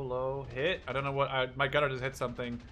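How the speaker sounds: ordinary talk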